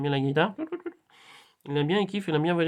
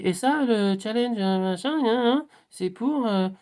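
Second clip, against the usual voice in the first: high, drawn-out announcer with hesitations; stretched-out, raised-pitch reveal; acted-out challenge announcement; 7 semitones higher, 4 dB louder, slower than usual. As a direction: build up to revealing the name, high and loud. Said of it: "Et là, il dit".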